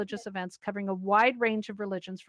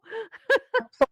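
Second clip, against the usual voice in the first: high giggle; 12 semitones higher, 4 dB louder than usual. Real, not acted. Laughs after complaint.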